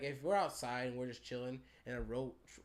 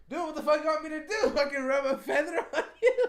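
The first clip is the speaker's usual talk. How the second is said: high, loud, laughing retort; mock outraged shout with a laugh; exasperated yell; 13 semitones higher, 12 dB louder than usual. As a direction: yell back half laughing at dad. Son retorts to angry dad.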